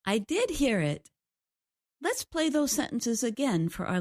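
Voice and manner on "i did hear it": "did" stretched and stressed. Shown as a demonstration. Co-host confirms to host she heard the stress, stress on "did". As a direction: lean on "did" to make it clear. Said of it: "you just used stress to make it clear".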